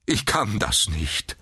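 despairing, mildly groaned protest; groaning. A narrator's reading. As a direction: moan it helplessly. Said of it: "stöhnte er". (verzweifelt stöhnend)

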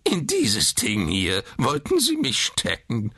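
slow, high, indignant squawk; squawking old man. A narrator's reading. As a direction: squawk it out slowly in outrage. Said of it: "quäkte er". (empört quäkend)

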